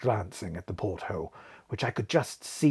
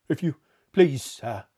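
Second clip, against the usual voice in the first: faltering; 3 semitones higher, 4 dB louder than usual. A narrator's reading. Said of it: "faltered Robert".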